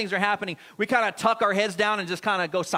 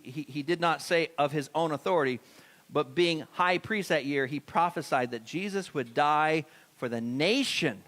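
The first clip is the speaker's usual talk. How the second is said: ordinary talk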